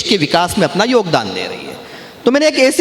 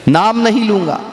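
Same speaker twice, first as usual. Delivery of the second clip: ordinary delivery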